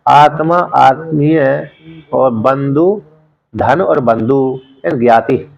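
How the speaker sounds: slow teaching list; slow enumeration. Shown as a demonstration. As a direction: count off each meaning slowly. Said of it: "स्व शब्द के चार अर्थ".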